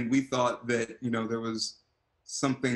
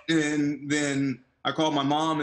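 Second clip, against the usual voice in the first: ordinary delivery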